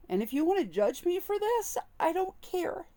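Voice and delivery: fighting back tears while confessing; crying. Real, not acted. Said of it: "and now I'm gonna cry".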